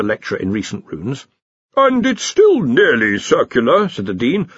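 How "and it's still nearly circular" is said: slow, high defensive excuse; sheepish excuse. A narrator's reading. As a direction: plead the point slowly. Dean excusing himself.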